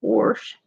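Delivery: "Warsh" with an R before the sh; regional pronunciation. Shown as a demonstration. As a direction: say "Warsh" with an added R, rhyming with "porch"-like "or". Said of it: "They say Warsh down here too".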